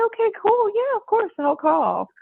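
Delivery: high, light offhand yes; breezy fake promise. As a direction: promise lightly, not meaning it. Promising to call, insincerely.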